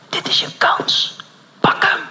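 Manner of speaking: slow unvoiced whisper. Holy Spirit urging the pastor, slow whisper. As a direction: breathe it out slowly as an inner voice. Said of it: "tot je fluistert".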